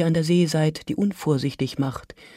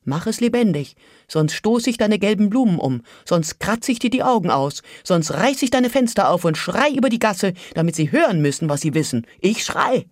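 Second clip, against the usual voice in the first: put-on a woman threatening the old crone, rising to a near shout; desperate threat; 3 semitones higher, 5 dB louder than usual. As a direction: hurl the demands, building to the scream.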